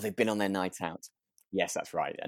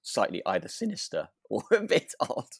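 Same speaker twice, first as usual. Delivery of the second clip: laughing whisper; 3 semitones higher than usual. Real, not acted. Aside while laughing.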